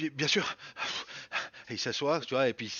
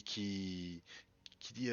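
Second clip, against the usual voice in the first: ordinary talk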